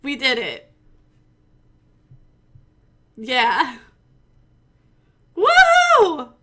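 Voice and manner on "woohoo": a very high, very loud cheer falling at the end; big woohoo. Acted. Winner cheering to her stream with a very loud, high woohoo. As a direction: scream it like you just won. Celebrating employee of the month win.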